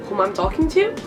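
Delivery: normal talking voice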